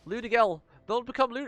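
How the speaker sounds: high, loud yell; desperate shout. Real, not acted. Player yells at the screen as she transforms, loud. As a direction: shout to stop her.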